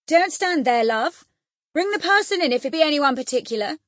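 loud, high, hurried call; quick exclamation. A narrator's reading. Husband calls from window to his wife below, quick exclamation. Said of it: "for he exclaimed quickly".

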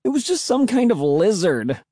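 high-pitched, amused teasing; laughing taunt. A narrator's reading. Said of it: "I laughed".

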